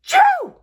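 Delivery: a loud sudden sneeze; big sneeze. Acted. Gerald sneezes across the room, loud sneeze. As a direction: sneeze it out hard.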